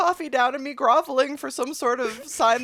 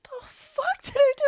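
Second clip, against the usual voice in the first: baffled; 12 semitones higher than usual. Retold or imitated. Inner reaction to rude stewardess.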